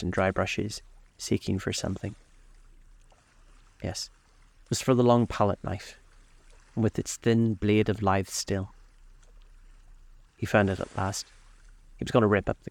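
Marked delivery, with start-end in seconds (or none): none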